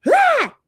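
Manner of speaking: sudden high yelp of fright; startled scream. Retold or imitated. Remembering the mask face, a sharp yelp. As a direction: shriek at the face in the window.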